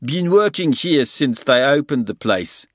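put-on gruff handyman in thick Afrikaans accent. A narrator's reading. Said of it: "he said in a thick Afrikaans accent".

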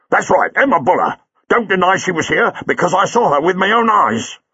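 a burly cabbie squaring up; cab driver Buller. A narrator's reading. Buller insists he saw her.

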